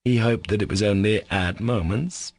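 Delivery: ordinary delivery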